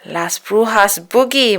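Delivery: ordinary delivery, nothing marked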